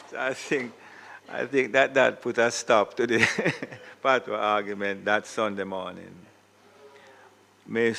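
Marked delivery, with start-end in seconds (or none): 0.1-6.1 amused laughter breaking up the words; laughing while telling. Real, not acted. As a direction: chuckle through the punchline of the anecdote. Chuckling at his own funny story.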